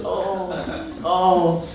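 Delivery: ordinary talk, nothing marked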